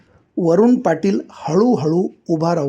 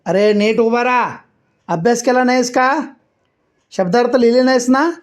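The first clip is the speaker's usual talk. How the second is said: exasperated teacher; 5 semitones higher than usual. A narrator's reading. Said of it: "sir म्हणाले".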